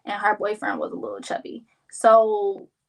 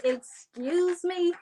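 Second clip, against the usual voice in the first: indignant excuse me; 7 semitones higher, 3 dB quieter, much slower than usual. Retold or imitated. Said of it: "I said".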